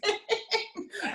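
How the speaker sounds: quick high laugh; a brief high giggle; short laugh. Real, not acted. Laughs about the cold.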